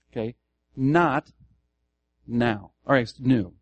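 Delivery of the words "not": "Not" raised and loud; stress on NOT. Shown as a demonstration. Pastor repeats the key word for listeners, "Not" stressed. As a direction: hit "Not" high and hard. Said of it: "the emphases here".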